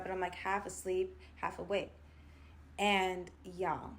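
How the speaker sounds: normal talking voice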